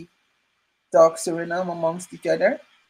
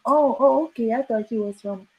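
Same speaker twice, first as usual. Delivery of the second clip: a lightly voiced local, higher; mock surprised local; 5 semitones higher than usual. Retelling a stranger's surprise at her origin, mock voiced. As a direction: play their surprise lightly, a bit silly.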